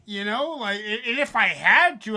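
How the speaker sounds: ordinary talk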